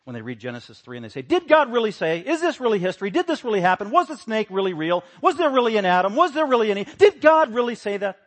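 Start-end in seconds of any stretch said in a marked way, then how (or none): 1.2-8.2 loud, high, sneering questions; mock the doubting Bible teachers; mocking skeptics. Preacher imitating skeptical teachers, loud mocking doubt. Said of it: "You sound like Satan".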